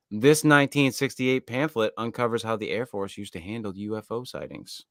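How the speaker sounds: ordinary talk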